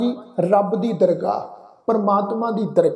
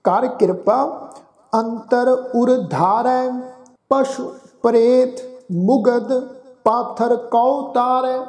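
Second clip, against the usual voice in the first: scripture recitation; 3 semitones higher, slower than usual. Acted. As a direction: intone each phrase with long pauses.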